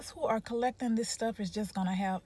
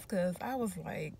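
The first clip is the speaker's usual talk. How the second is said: normal talking voice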